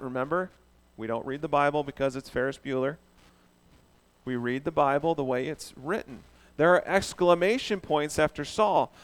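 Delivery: ordinary delivery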